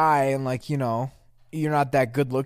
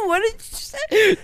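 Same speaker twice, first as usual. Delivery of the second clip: high, loud, slow laughing question; laughing disbelief; 4 dB louder, slower than usual. Real, not acted. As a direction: shout it through laughter.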